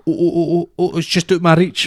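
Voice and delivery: high-pitched little run of ohs; mock flustered chaser. Mimicking a man chasing a ping pong ball, high flustered voice. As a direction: imitate someone fumbling just short of it.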